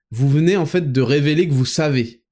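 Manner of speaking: usual speaking voice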